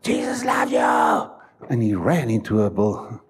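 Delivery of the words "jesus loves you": shouted out to the street, slow and drawn; street preacher shout. Retold or imitated. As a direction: cry it out to passers-by. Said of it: "he screamed it out".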